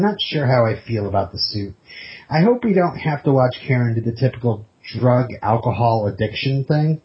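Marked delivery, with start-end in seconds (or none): none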